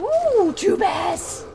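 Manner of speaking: loud, high squeal of delight; thrilled yell at spotting a second bear; excited shout. Real, not acted. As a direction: yelp it out, thrilled. Spotting bears in game.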